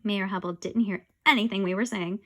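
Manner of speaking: ordinary delivery, nothing marked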